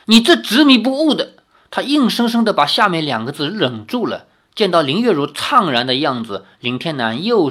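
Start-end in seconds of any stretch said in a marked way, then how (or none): 0.1-1.3 high, loud, raging father; furious outburst. A narrator's reading. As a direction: shout it, choking on rage. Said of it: "林天南气得一击击案". (气急败坏地怒吼)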